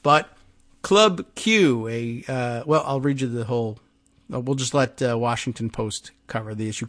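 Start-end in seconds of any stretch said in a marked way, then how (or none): none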